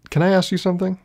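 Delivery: lifted pitch, emphasis on ask. Shown as a demonstration. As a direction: ask it again, voice up high.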